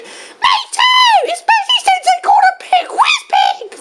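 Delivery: extremely high cartoon outburst; high-pitched mock fury; angry Diddy Kong. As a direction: rant in a squeaky-high character voice. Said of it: "But calm down, Diddy".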